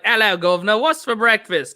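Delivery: cartoonish English accent; mock English accent. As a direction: put on a stock English "governor" accent. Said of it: "trying to do an English accent".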